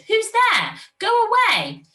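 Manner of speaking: high, loud fox shout. Acted. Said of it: "Out came a fox who shouted".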